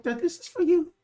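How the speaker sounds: high, timid delivery woman; meek messenger. Acted. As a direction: hand it over in a high, timid voice. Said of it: "meek, just looking postal service woman".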